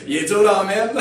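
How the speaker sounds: laughing out the words, loud and high. Real, not acted. Preacher laughing at his own hard words to the congregation. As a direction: chuckle through it at the congregation.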